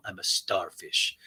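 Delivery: soft, slow, breathy whisper. Retold or imitated. Ubah whispering her bedroom confession to the group. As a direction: confide it in a hush.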